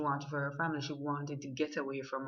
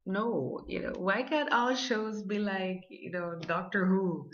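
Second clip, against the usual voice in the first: ordinary delivery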